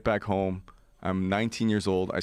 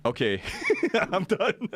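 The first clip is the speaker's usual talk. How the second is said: laughing, much higher and slow; laughing give-up; 11 semitones higher, slower than usual. Retold or imitated. Hearing about the parasites, laughing high surrender. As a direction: quit with a helpless laugh. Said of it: "And I'm like".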